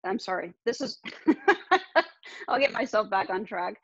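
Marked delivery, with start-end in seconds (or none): 1.0-2.0 high giggle; embarrassed laugh. Real, not acted. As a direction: laugh at yourself. Loses track, laughs.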